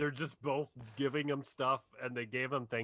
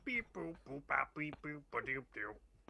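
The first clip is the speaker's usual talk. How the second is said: robot beeps; 6 dB quieter than usual. Pretend. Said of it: "I am Bumblebee".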